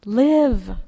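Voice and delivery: a single word called out loud and falling; shouted plea. Retold or imitated. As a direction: call him back to life with one word. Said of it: "we shouted".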